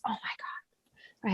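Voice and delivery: slow, barely-there whisper of disbelief; exasperated whisper. Real, not acted. To the host about endless videos, a worn-out whisper. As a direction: breathe it out, worn out by it all. Overwhelmed by recording so many videos.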